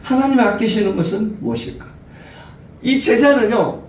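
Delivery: ordinary delivery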